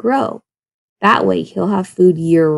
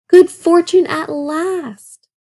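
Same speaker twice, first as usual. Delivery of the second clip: slow, higher gloating wolf; delighted; 8 semitones higher, much slower than usual. A narrator's reading. Old wolf gloats over the plump goat, slow and higher. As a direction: savour the words like a prize.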